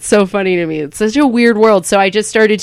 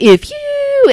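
"if you" swooping way up high; drawn-out setup phrase. Shown as a demonstration. Comic showing the key to the joke, "if you" stretched high. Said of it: "the important part is to get that".

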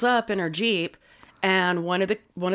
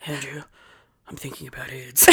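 whispered; 5 semitones lower, 10 dB quieter than usual. Retold or imitated. Husband's deadpan line retold.

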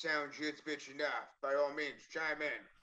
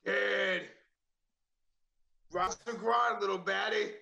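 higher, louder, hammed-up come-on; bad-boy message; 5 semitones higher, 5 dB louder, slower than usual. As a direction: belt out the cheesy pickup line. Performing cheesy bad-boy message.

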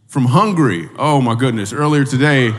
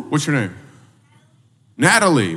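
ordinary talk, nothing marked

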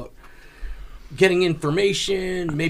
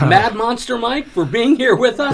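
excited; 7 semitones higher, 7 dB louder than usual. Real, not acted. Host hypes guest's nickname.

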